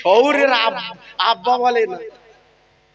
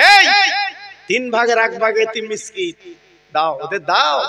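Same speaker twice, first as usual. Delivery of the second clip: ordinary talk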